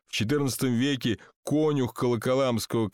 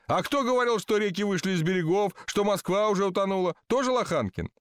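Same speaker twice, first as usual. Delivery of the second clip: higher-pitched indignant retort; mildly outraged, without raising the volume; 6 semitones higher than usual. A narrator's reading. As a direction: throw the accusation back at them. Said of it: "возмущённо сказал Васисуаль Лоханкин".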